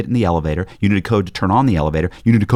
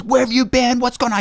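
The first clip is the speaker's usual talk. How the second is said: high, frantic mock wife on the phone; panicked wife; 14 semitones higher than usual. Wife demanding where he has been, high and frantic. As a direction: pitch up like a worried wife.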